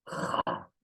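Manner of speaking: ja breathed out slowly, a soft throaty rasp; teacher demonstrating ja; whispered ja. Shown as a demonstration. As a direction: let ja come out as a slow breathy sound. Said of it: "que los españoles no han podido pronunciar".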